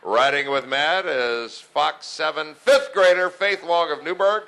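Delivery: ordinary talk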